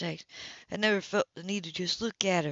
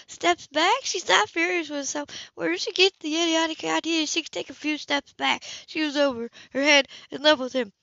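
higher, louder, self-scolding; narrating her fury at herself; frustrated inner voice; 8 semitones higher, 5 dB louder than usual. A narrator's reading. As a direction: scold yourself, exasperated.

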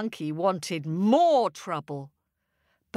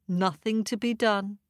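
usual speaking voice

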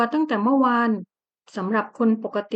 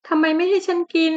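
pitched-up weepy scolding; sobbing complaint; 6 semitones higher than usual. Acted. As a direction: whine it out through sobs like a hurt woman. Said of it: "หล่อนต่อว่าสะอื้น".

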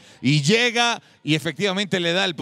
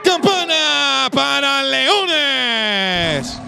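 long stretched shout, high and slow; drawn-out victory call; 5 semitones higher, 3 dB louder, much slower than usual. Real, not acted. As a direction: hold the call like a goal cry. Announcing the winning point.

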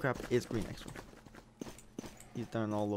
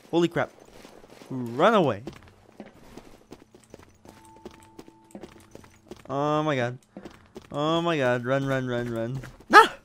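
loud panicked fleeing cries; being chased; 2 semitones higher, 10 dB louder than usual. Real, not acted. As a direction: yell it while running for your life. Killer coming after him.

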